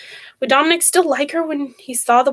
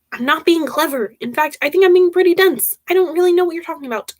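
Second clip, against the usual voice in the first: raised pitch, defensive and baffled; exasperated protest; 7 semitones higher than usual. Acted. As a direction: protest that you honestly don't understand. Dominic protests in phone quarrel.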